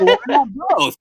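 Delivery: lightly acted, high-pitched ad tagline; taco commercial catchphrase. Capping the cockpit story with the taco commercial's catchphrase, lightly acted. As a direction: lift the pitch for a quoted catchphrase. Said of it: "that taco commercial".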